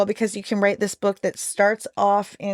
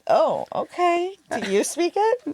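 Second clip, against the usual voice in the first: surprised; 10 semitones higher than usual. Real, not acted. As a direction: caught off guard, pitch jumps up. Surprised at the reply.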